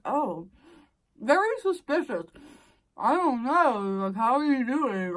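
slow, high, shifty neighbor; sketchy neighbor voice. Retold or imitated. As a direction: play him cagey and suspicious. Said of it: "He seemed, like, real sketchy".

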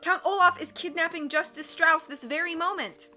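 high, loud urgent plea; begging cry. A narrator's reading. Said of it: "Violet begged the crowd".

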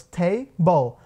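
"Table" split into TAY and BOL; two-syllable breakdown. Shown as a demonstration. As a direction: say "Table" one syllable at a time. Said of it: "Table tem duas sílabas". ("Table" separado em duas sílabas)

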